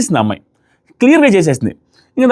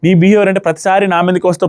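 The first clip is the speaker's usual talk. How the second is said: usual speaking voice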